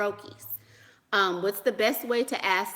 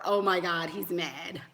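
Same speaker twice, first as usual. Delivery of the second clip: usual speaking voice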